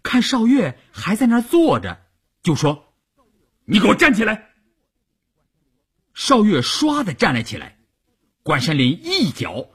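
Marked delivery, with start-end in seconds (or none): 3.7-4.5 high, heated command; angry order. A narrator's reading. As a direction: order them up in a temper. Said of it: "关山林发着火儿". (发着火厉声命令)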